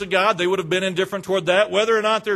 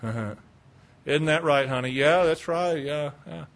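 low, slow, idle agreement; mock complacent churchgoer; indifferent; 5 semitones lower, much slower than usual. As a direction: nod along without caring.

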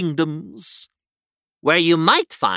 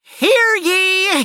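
town crier; 11 semitones higher than usual. Acted. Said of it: "learns the local customs and language".

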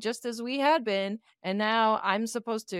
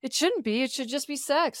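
ordinary talk, nothing marked

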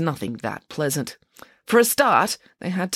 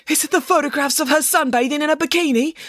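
high, eager question from the press pack; young journalist calling out; 11 semitones higher than usual. A narrator's reading. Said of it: "called a young journalist".

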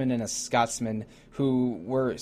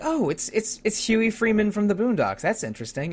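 high, delighted realization; surprised recognition; 9 semitones higher than usual. Retold or imitated. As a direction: catch on suddenly, pleased. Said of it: "and I'm like".

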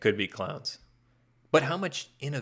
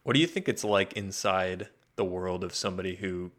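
ordinary talk, nothing marked

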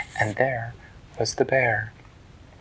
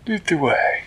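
higher, formal and polite small hero; Flippertigibbet voice; 7 semitones higher, 4 dB louder than usual. Acted. Flippertigibbet greeting the turtle, high and polite.

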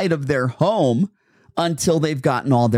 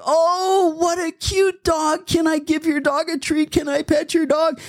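very high, sing-song cooing over a dog; squeal-pitched mock stranger fawning over a puppy; gushing dog lover. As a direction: imitate an overexcited dog admirer. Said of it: "here comes some person going".

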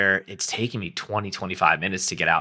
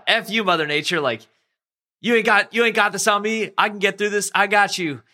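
much higher, censored mock-furious challenge; cleaned-up curse thrown back at the waves; defiant angry; 10 semitones higher than usual. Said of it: "then the anger starts kicking in".

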